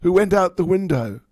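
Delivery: put-on high, loud, startled old woman demanding answers; half-blind mother-in-law shouting after the intruder; suspicious old Jatila. Old Jatila spots someone leaping out the window, high loud demand. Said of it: "And Jatila said".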